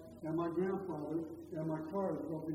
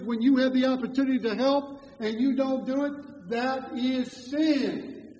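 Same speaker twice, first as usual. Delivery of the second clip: louder, with that is sin dragged out very slowly; solemn emphasis; 4 semitones higher, 8 dB louder, slower than usual. Real, not acted. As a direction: hammer home the verdict. Preacher declaring God's judgement.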